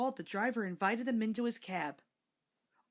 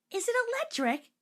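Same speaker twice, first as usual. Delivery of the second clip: put-on much higher curious girl's voice; little girl; 4 dB louder than usual. A narrator's reading. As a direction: ask it like a small girl. Little girl Bridget asks.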